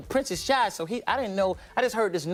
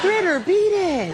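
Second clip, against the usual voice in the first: [name] impression; 11 semitones higher, 7 dB louder, much slower than usual. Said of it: "[name] parody".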